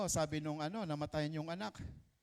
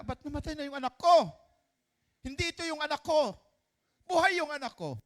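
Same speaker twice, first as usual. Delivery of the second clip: high, loud frantic cry of a mother; anguished mother; 11 semitones higher, 7 dB louder than usual. Acted. Mother waking beside a dead baby, crying out high and frantic. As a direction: panic rising as she finds the baby dead.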